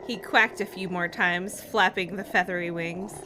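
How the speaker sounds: ordinary talk, nothing marked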